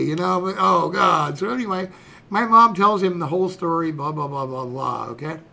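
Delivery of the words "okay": normal talking voice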